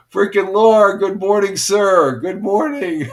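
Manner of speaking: loud, high, delighted greeting; excited greeting. Real, not acted. Streamer greeting Laura in chat, loud delighted hello.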